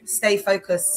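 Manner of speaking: ordinary talk, nothing marked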